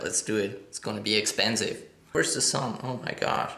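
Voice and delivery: usual speaking voice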